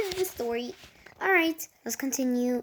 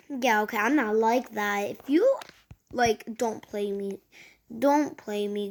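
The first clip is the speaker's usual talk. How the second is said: usual speaking voice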